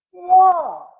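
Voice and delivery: high, loud amazed whoa; big wide-eyed whoa. A narrator's reading. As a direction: gasp it out in wonder. Narrator marvels at information.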